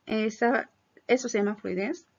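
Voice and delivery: ordinary delivery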